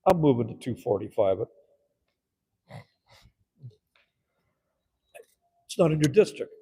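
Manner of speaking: loud and forceful; council chamber argument. Retold or imitated. Council member argues the motion, loud and forceful. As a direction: state the motion hard. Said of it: "And I'd go".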